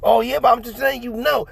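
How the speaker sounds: high put-on mom voice; high mock mom reply. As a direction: mimic mom's pointed retort, high. Mom voiced, store dispute.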